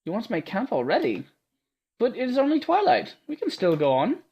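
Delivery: put-on high, eager girl's voice; awed young heroine praising her protector; timid young girl. Awed Ada gushing at Martha, high and breathless.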